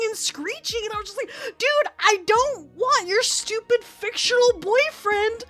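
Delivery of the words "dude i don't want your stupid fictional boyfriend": slow, loud, higher exasperated shout; mock outraged yelling. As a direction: spell it out at her, fed up. Yelling at friend mid-fight.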